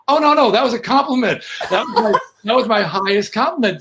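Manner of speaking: re-enacted, laughing denial; laughing reassurance. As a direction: hurry to reassure through chuckles. Retold amused reassurance to offended singer.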